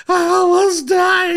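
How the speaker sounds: loud high bawling, slow; mock hysterical wail; crying. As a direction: blubber it out like a hammed-up breakdown. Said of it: "So I'm crying".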